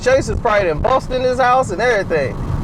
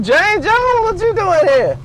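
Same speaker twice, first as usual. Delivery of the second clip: surprised; 8 semitones higher, slower than usual. Acted. Surprised at visitor.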